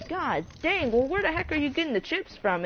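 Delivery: a stunned, curious question; amazed disbelief. Retold or imitated. Amazed at friend's sales.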